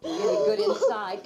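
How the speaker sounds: ordinary delivery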